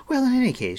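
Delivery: put-on high, loud, rushed girl's chatter; sudden high-pitched gabble; breathless rate. A narrator's reading. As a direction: burst back into rapid chatter. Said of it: "resumed at her usual breathless rate".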